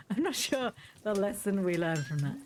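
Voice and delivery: ordinary talk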